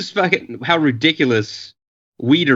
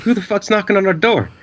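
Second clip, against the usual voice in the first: panicky; 7 semitones higher than usual. Retold or imitated. Retold panicked question.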